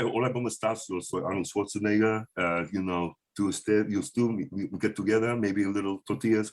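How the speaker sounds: lightly voiced Arnold in Spanish with an Austrian accent; low, heavy Austrian accent in Spanish; Arnold Schwarzenegger speaking Spanish. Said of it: "Arnold, working on that guy".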